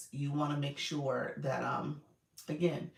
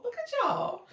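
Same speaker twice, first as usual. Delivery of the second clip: excited; 6 dB louder than usual. Real, not acted. Excited about hitting viewer milestone.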